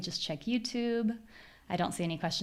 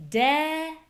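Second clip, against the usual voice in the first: "desert" with DES stretched and high; exaggerated first-syllable stress on "desert"; high long stressed vowel; 8 semitones higher, 3 dB louder than usual. Shown as a demonstration. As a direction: hold a high "Deee" in "desert", short "zert". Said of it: "My voice is higher in pitch".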